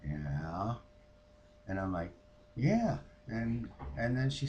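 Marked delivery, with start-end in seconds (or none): 0.0-0.8 low, quiet questioning rise; retold friend's prompt. Friend's prompt, then her answer. 2.6-3.0 high, emphatic yes; retold confirmation. Her answer to the prompt.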